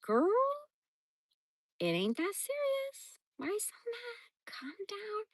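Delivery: high, soft, breath-light pet voice; tiny hushed voice for a calming dog; dog's imagined voice. Pretend. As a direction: soothe your owner in a small, high whispery voice. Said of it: "just looks at me like".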